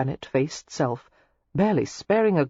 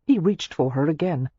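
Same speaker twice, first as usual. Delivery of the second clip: ordinary delivery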